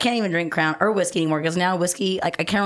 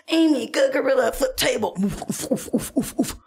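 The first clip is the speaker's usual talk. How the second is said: gorilla impression; 6 semitones higher than usual. Woman acting her gorilla spirit animal, hooting grunts. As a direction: coax yourself then huff like a gorilla. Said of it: "Be like".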